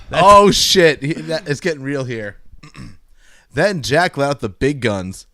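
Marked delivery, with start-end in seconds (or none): none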